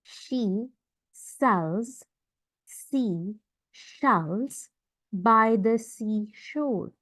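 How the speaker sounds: "She... sells... sea... shells", very slow with gaps; word-by-word demo. Shown as a demonstration. Teacher shows students the S and SH sounds, word-by-word demo.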